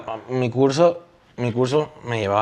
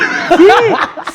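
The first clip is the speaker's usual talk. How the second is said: shrieking laugh, much higher and louder; high-pitched burst of laughter; 7 dB louder than usual. Real, not acted. Old school friend cracks up over the vibrating phone, squealing laugh. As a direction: let out a squealing laugh at the memory.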